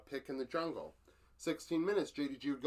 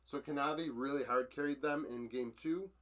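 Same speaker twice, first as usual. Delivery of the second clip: ordinary talk, nothing marked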